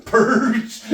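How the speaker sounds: giggling through the word; laughing. Real, not acted. Laughing at own mistake.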